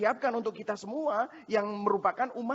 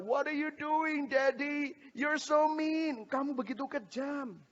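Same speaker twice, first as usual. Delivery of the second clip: put-on high, slow reproachful child; hurt toddler voice; 9 semitones higher, slower than usual. Toddler telling daddy he is mean, hurt child. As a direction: voice the wounded little boy accusing.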